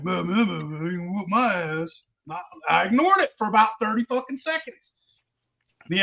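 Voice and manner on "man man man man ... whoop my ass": imitated slurred mumble; mumbling. Wannabe dude muttering back at a drunk challenger, mumbling. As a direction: mumble the words as a mocking imitation. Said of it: "Started mumbling".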